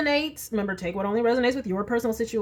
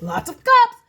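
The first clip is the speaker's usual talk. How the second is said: excited; 6 semitones higher, 5 dB louder than usual. Real, not acted. Surprised at many cup cards.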